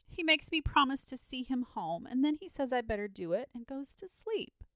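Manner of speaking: put-on high, tearful child's complaint; sobbing. A narrator's reading. Tired boy explaining to Saint George, sobbing. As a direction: sob it out, breaking down at the end. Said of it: "sobbed the boy".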